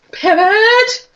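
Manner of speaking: high, drawn-out yell; Ross shouting Pivot. Retold or imitated. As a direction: bark the order, frantic. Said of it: "Friends quote".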